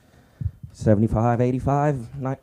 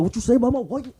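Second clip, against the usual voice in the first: mock angry husband; 12 semitones higher, 3 dB louder, faster than usual.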